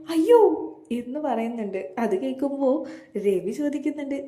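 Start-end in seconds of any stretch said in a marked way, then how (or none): 0.1-0.7 high, loud cry of അയ്യോ in dismay; shocked shriek. A narrator's reading. Mother-in-law hearing the pregnancy news, loud shocked cry. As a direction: blurt it out in loud alarm. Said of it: "നീ എന്തിനാ ഇങ്ങനെ അലറുന്നത്".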